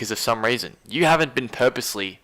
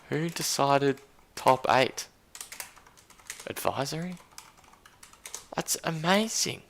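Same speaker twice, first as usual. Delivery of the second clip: stunned questioning, voice still pitched up; incredulous; 6 semitones higher than usual. Real, not acted. Still baffled by who decided.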